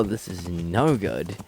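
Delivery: ordinary talk, nothing marked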